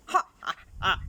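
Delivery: mocking laugh. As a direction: laugh at the tiny chameleon's threat. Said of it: "laughed Crocodile".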